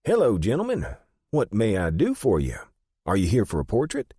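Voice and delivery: high-pitched, eager little shopkeeper voice; brisk small man. A narrator's reading. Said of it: "brisk, small man".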